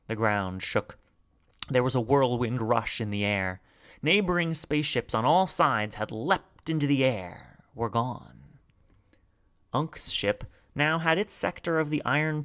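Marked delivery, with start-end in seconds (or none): none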